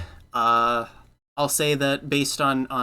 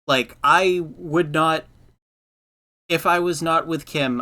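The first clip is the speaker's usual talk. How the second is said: normal talking voice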